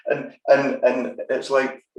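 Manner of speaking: ordinary delivery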